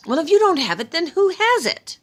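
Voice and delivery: high, loud pleading; exasperated plea. Acted. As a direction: beg him to stop the game. Thor pleads, then demands.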